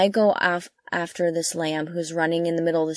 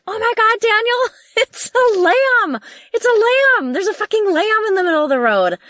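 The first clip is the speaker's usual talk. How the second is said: much higher and louder, squealing with disbelief; laughing amazement as she realizes it's a lamb; excited shriek; 14 semitones higher, 7 dB louder than usual. Retold or imitated. Retelling discovering a lamb on road.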